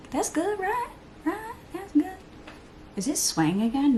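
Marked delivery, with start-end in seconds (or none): 0.1-2.1 lifted, much higher voice asking for approval; high-pitched check-in. Real, not acted. Vlogger asking viewers if her brows look good, high and coaxing. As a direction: sing it up high, fishing for a yes.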